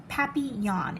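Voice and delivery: papillon said pa-pee-YON, French style, nasal end. Shown as a demonstration. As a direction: say papillon the French way, stress on the last part. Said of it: "You say it like this".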